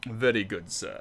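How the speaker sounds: a hint of a deferential butler; Duckworth. Answering chat by quoting Duckworth's last words, lightly voiced butler. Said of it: "a Butler impression right there".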